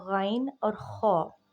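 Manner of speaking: slow, careful गाइन और खौ with throaty ग़ and rasped ख़; pronunciation demo. Shown as a demonstration. Teacher naming the letters for students, slow and careful. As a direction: spell out गाइन and खौ slowly from the top of the throat. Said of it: "वो मैं आपको बताऊँगी".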